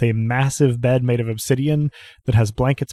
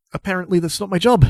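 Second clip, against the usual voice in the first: higher, quick, bitter aside; 7 semitones higher, faster than usual. Acted. Mayor to a guest about her lost job, bitter. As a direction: toss it off with wounded bitterness. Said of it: "then she gives a bitter laugh".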